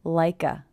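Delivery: "like a" joined as "lie-kuh"; "like a" as one word, no gap; linked K. Shown as a demonstration. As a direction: carry the K of "like" into a schwa "a". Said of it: "the K linking to the schwa".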